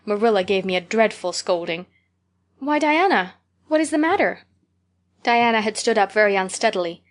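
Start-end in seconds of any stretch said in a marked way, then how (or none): 2.6-4.4 higher, startled concern; alarmed. Acted. Anne startled as Diana stands up unsteadily. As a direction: ask it in sudden alarm.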